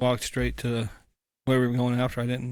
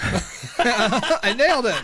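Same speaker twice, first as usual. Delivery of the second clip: high, drawn-out words through laughter; laughing; 10 semitones higher, 4 dB louder, much slower than usual. Real, not acted. Pleased at passing for a Biden impression, laughing. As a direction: crow it while cracking up.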